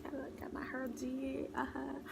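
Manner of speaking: whispered. Shown as a demonstration. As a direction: breathe it out as a whisper.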